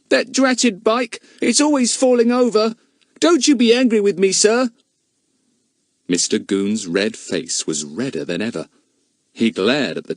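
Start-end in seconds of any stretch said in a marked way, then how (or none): 0.1-4.7 put-on pleading boy. A narrator's reading.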